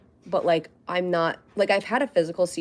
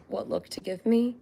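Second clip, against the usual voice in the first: usual speaking voice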